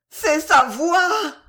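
high, loud, drawn-out exclamation; swooning aunt's loud outburst; hysterical cry. A narrator's reading. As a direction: cry out slowly at a high pitch. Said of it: "s'écria la tante demoiselle".

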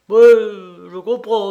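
loud, slow, drawn-out mock understanding; comic sympathy. A narrator's reading. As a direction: lay on the sympathy with comic exaggeration. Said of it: "prenait des tons si comiques". (compassion comique et exagérée)

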